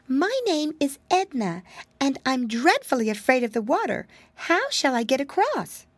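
put-on high, fretful little girl voice; a tiny frightened mouse wailing; timid mousy girl. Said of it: "she said".

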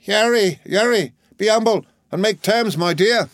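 high, alarmed pleading; agitated crying out. A narrator's reading. Alarmed mother begs her son to be humble, agitated. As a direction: plead in agitation, pitched well up. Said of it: "who cried out in much agitation".

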